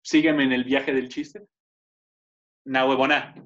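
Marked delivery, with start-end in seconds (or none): 2.7-3.3 lightly acted teacher, low and loud; hint of a firm Venezuelan teacher; Venezuelan Spanish teacher. Imagined Venezuelan teaching Spanish class, lightly voiced teacher. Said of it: "yo imagino un venezolano dando clases de español".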